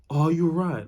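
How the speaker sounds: loud sharp demanding "Are you right?"; loud wrong way. Shown as a demonstration. Coaching viewers, the wrong loud check-in. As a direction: bark it out. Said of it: "not like".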